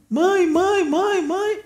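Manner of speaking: high, strained cry of mãe, mãe; dying soldier calling mother. Retold or imitated. Dying young soldier calls desperately for his mother. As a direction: call out for mother like a dying boy. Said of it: "ele começa a chamar a mãe".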